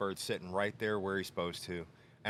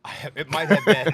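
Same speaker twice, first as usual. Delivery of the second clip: laughing admission; 8 semitones higher, 16 dB louder than usual. Real, not acted. Laughs admitting slug.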